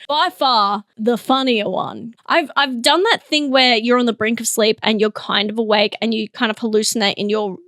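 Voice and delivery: usual speaking voice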